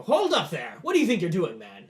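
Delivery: loud, high challenge down the line; commanding shout. Acted. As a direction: call a halt like an officer. General calls out a halt.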